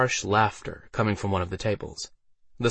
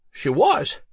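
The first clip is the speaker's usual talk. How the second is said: high, loud tipsy answer; pitched-up drunkard's voice; Harold the drunk, confirming; 9 semitones higher, 5 dB louder than usual. Acted.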